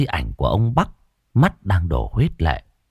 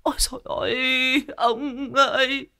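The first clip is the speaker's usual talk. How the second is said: keening wail; 3 dB quieter than usual. A narrator's reading. Widow wailing over her husband's coffin, sobbing lament. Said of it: "bà Bắc lại càng kêu gào thảm thiết".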